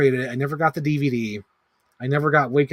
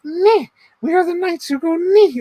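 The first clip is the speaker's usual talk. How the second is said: much higher, put-on silly knight voice; Monty Python quote; 6 dB louder than usual. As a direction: squeak it out like a goofy knight. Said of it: "if you're quoting".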